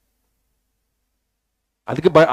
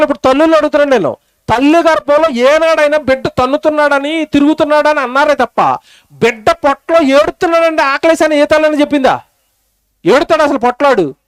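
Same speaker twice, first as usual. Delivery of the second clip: ordinary delivery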